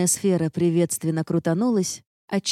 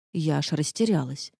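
ordinary delivery

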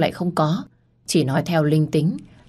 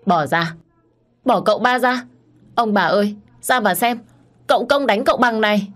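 shrill yelling; 5 semitones higher than usual. Acted. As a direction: yell for the old couple to come quick. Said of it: "con Tí la oang oang".

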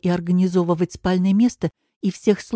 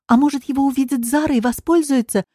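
high-pitched, eager suggestion; Katya's high, enthused voice; suddenly hopeful; 7 semitones higher than usual. A narrator's reading. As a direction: perk up with a new idea. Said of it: "воодушевилась Катя".

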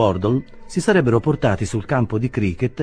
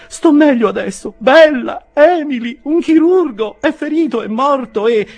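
very high, loud falsetto panic; hysterical; 10 dB louder than usual. A narrator's reading. Spinster aunt to her nieces about the wounded man, hysterical. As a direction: build frantically towards a hysterical fit. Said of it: "crisi numero due di risa isteriche".

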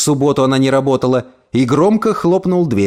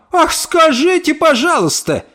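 high, drawn-out scornful exclamation; mocking retort. A narrator's reading. Neighbour retorts to the angry mother, mocking retort. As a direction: throw it back with mock offence.

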